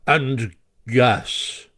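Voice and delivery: a hint of gruff common Badger; blunt Badger. A narrator's reading. Badger cutting in on Rat, blunt and common. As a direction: drop it in bluntly. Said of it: "in his common way".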